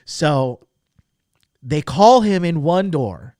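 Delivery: ordinary talk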